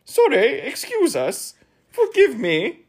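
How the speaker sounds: very high, loud character voices; high, cowed little apologies one after another; apologizing in turn. A narrator's reading. Scolded guests apologizing in turn to the king, very high. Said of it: "they apologized in turn".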